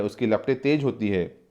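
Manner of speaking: ordinary talk, nothing marked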